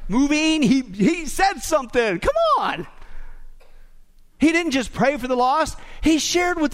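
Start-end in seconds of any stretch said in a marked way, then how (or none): 2.2-2.9 mock urging.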